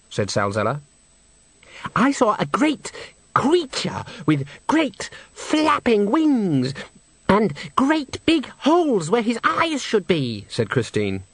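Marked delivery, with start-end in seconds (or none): 2.0-10.5 put-on high, slow dramatic girl; Christine's breathless voice. A narrator's reading. Soprano to the director, high and slow gushing. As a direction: gush it out like a melodrama.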